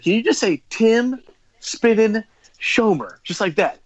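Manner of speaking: slow, high, one word per beat. Retold or imitated. DJ showing a six-year-old his drop, slowly on beat. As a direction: coach a child, spacing each word out. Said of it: "say it on beat".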